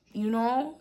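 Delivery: usual speaking voice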